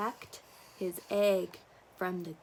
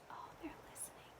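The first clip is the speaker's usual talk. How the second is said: whispering; 23 dB quieter, much faster than usual. Real, not acted. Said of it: "whisper to child".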